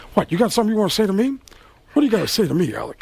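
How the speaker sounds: a fast, confrontational Pacino; a tough guy daring him to speak; Al Pacino impression. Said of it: "and Al Pacino".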